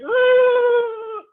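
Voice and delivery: high loud frightened ooh; high shouted ooh; scared shout. Acted. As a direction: shriek in fright. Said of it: "Chipu said".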